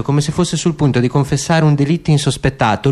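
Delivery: normal talking voice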